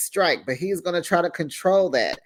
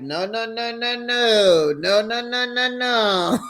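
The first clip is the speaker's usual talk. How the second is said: high, sustained string of refusals; mock objection; 6 semitones higher than usual. Friend cutting in on Doreen's advice, high mock objection. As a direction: mimic a friend heading off bad advice, pitched way up.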